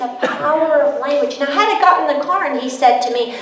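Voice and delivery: ordinary talk, nothing marked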